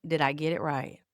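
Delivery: put-on low North Carolina Appalachian accent; a fond, deliberate Appalachian imitation; David. Leader checking with his team, in an Appalachian accent. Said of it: "Way David said it was just so charming".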